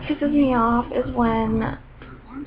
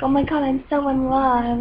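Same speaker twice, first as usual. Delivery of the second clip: mock lovestruck girl. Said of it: "she's like".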